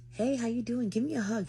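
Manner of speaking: high, sweet-talking greeting; flirty sauce. Retold or imitated. Re-enacting her greeting to her date, flirty. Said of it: "I was putting that sauce".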